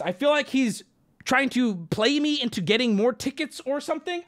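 ordinary talk

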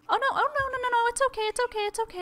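very high cooing to calm a pet. Real, not acted. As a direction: coo at a scared bunny in a tiny voice. Soothing the startled rabbit.

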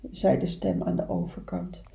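usual speaking voice

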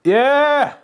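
high, loud victory shout; real triumphant whoop; triumphant cheer. Real, not acted. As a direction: cheer as you beat the game. Triumph after finishing.

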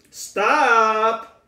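a playful silly voice for a speech device. Shown as a demonstration. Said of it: "that's my silly voice".

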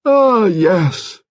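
high, anguished exclamation; crying out. A narrator's reading. Lady Lacklander owning her husband's remorse, crying out. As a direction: cry out slowly in bitter agreement. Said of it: "she cried out".

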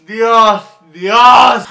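Dios building to a loud despairing cry; hammed-up despair to heaven; mock shouting.

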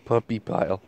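normal talking voice

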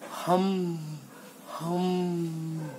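steady, level humming like a bee; bee hum. Acted. Honeybee opening its monologue, humming. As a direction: hum softly on one held note.

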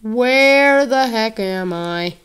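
drawn-out loud bewildered call; slow loud puzzled shout; loud slow exclaim. Real, not acted. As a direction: call out lost and confused, slowly. Lost in game, exclaims.

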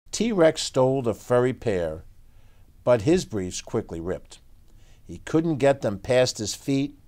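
put-on lively read-aloud for kids; story narration. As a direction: tell it like a bedtime picture book. Reads picture book aloud.

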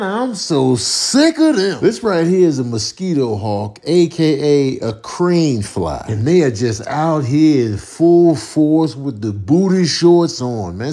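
ordinary delivery

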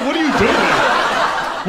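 slow, high, loud exasperated yell; mock outraged shout. Comic scolding an attempted murderer, slow disbelieving yell.

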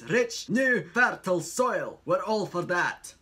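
put-on Scottish accent. Asked for another accent on the blurb, Scottish accent. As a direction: read it as a Scot, building to a bigger ending.

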